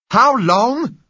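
loud, high shouted question. Acted. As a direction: yell it through the gates. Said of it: "shouted the man on our side".

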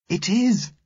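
put-on very high child's voice, excited; thrilled, high-pitched child; excited cry. A narrator's reading. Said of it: "Susan cried excitedly".

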